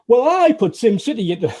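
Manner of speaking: a hint of smug one-upmanship; retorting quote. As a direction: deliver the punchline as a proud brag. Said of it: "And I went".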